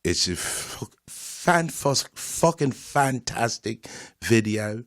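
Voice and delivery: London street accent, put on; mock British accent. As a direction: talk like a British road man. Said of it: "I don't know if I can stop talking like this".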